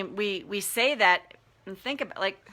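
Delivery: usual speaking voice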